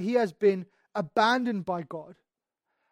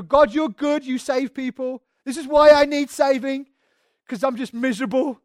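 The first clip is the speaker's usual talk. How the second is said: louder, insistent appeal to God; acted plea; 2 semitones higher, 7 dB louder than usual. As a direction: plead up to God, forcefully. Pleading with God for rescue.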